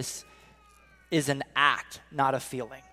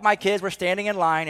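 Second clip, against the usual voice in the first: ordinary talk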